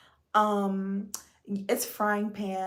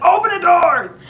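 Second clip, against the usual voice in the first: loud, high call to open the doors; desperate shout for the doors; urgent shout; 8 semitones higher, 13 dB louder than usual. Retold or imitated. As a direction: yell at the train to open up. Quoting herself as train arrives.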